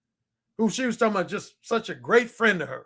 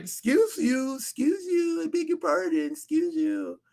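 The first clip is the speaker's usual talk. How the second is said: higher, indignant excuse you; offended woman; 8 semitones higher, slower than usual. Retold or imitated. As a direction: play the affronted lady. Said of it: "she was like".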